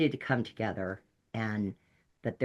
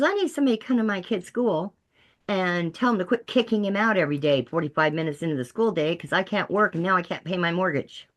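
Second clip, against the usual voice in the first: high, worked-up venting; retold exasperated plea; exasperated demand; 6 semitones higher than usual. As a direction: vent like a fed-up parent, pitched up. Exasperated mother venting her demand.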